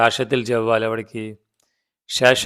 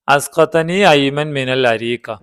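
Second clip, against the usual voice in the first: usual speaking voice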